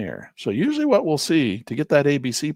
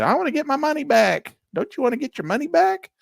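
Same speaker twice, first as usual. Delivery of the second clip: higher, pleading mock trader; hammed-up small trader, higher and pleading; eager trader wanting money back; 13 semitones higher, 4 dB louder than usual. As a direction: coax like someone desperate to recoup losses.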